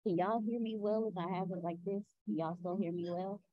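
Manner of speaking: muffled, mic held away. Real, not acted. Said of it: "You sound muffled".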